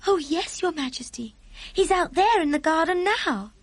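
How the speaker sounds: put-on high, eager child voice; small girl, polite and sincere; earnest little girl. A narrator's reading. Sophie assures Queen about the giant, high and earnest.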